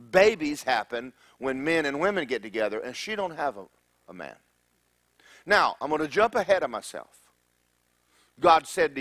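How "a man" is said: ordinary delivery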